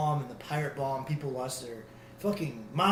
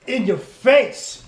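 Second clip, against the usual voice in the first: slow, loud punchy yell; hype shout; 9 semitones higher, 14 dB louder, slower than usual. Acted. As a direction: shout it right at them. Hyped show intro shout.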